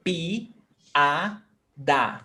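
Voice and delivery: "Pi-a-da" in three clean syllables, each one separate; syllables split. Shown as a demonstration. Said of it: "listen very carefully".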